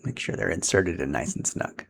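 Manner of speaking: ordinary talk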